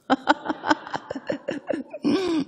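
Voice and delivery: high laugh trailing down. Real, not acted. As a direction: laugh at own punchline. Laughing at punchline. (자기 농담에 웃는 웃음)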